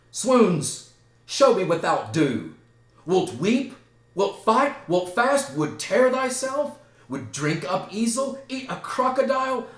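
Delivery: loud, high taunting questions; ranting challenge. Acted. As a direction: fire off dares, each one rising. Hamlet rants, taunting Laertes.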